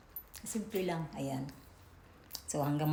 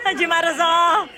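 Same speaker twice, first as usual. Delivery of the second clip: high, loud rallying call; excited shout; 9 semitones higher, 10 dB louder than usual. Real, not acted. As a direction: shout it out to the whole party. Shouted announcement to the group.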